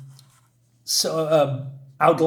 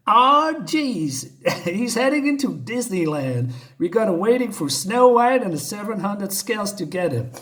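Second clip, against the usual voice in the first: higher-pitched, hammed-up cop exasperation; exasperated cop; 5 semitones higher than usual. Cop complains to colleagues about a chase into Disneyland, mock exasperation. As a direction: groan the opening, then grumble on in a raised voice. Cop's exasperated line acted from comic.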